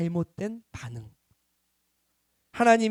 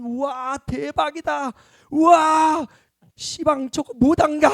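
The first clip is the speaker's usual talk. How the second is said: amazed onlooker; 8 semitones higher, 5 dB louder, slower than usual. Retold or imitated. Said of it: "같은 기적을 보고도".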